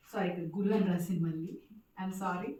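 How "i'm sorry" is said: ordinary delivery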